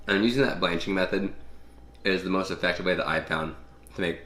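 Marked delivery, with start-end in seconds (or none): none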